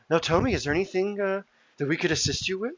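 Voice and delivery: eager host. Acted. GM voicing helpful NPC host.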